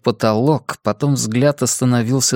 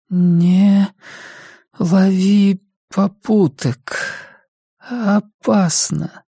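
high, very slow warning in fragments; weakly caution, pausing after each effortful word; frail, labored mother; 8 semitones higher, much slower than usual. Acted. Sick mother warns departing son about hitchhiking, frail and labored. Narrator voices the ailing mother.

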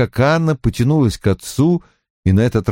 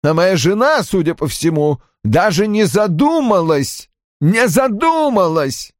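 high-pitched, anguished indignation; distraught outburst; 9 semitones higher than usual. A narrator's reading. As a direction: voice an outraged husband on the verge of tears. Разгневанный, отчаявшийся Рой перед рыданиями. (возмущение на грани слёз, высоким голосом)

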